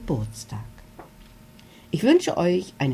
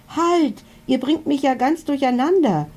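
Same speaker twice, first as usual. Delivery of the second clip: high, flustered cry of Halt; little dragon calling for order; flustered stop; 9 semitones higher than usual. A narrator's reading. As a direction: Milo flustered, voice jumping up. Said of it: "sagt Milo".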